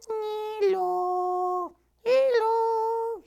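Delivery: very high tiny flower voice; squeaky puppet voice. Acted. Flower puppet greeting the audience in a tiny high voice. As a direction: pipe it up like a little flower puppet.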